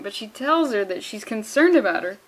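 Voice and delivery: normal talking voice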